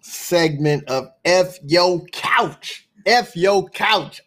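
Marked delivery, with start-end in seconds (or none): 1.2-2.9 F Yo Couch drawn out slowly as a show title; catchphrase. Real, not acted. Host naming the segment, F Yo Couch as drawn-out catchphrase. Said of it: "say it like that". 3.1-4.2 F Yo Couch slow, each word punched; catchphrase. Real, not acted. Host repeating the segment title, F Yo Couch as punched catchphrase. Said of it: "I don't know why I gotta say it like that".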